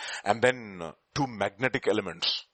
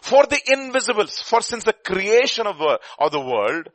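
high, loud solemn reading; scripture reading; 6 semitones higher, 5 dB louder than usual. Acted. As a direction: proclaim the verse. Reads verse twenty aloud.

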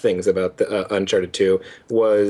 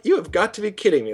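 high, quick mock disbelief; exasperated, pitched-up protest at something absurd; incredulous reaction; 7 semitones higher, faster than usual. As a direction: act out fed-up incredulity. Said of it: "It was like".

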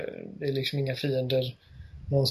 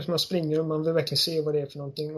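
ordinary talk, nothing marked

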